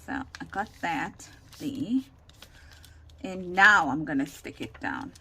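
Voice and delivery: ordinary delivery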